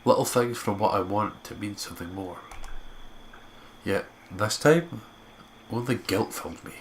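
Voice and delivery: steady storyteller read; story narration. Acted. Narrating the walk back to school, even storyteller tone. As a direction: carry the listener through the scene. Reads visual novel narration.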